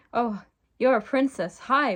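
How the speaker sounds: excited cry. Acted. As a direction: exclaim it on meeting a princess. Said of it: "Bugsy cried".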